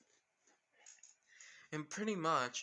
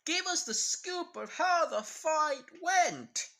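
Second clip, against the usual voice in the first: much higher, louder, slow clamoring demand; eager audience; 14 semitones higher, 9 dB louder, slower than usual. Retold or imitated. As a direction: mimic impatient fans begging for details. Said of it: "you guys are gonna be like".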